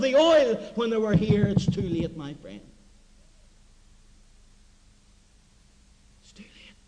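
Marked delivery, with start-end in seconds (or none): 1.5-2.6 fading to a whisper. Acted. Preacher repeats warning, fading. 6.2-6.7 softer, whispered warning. Acted. Repeat of "too late" whispered.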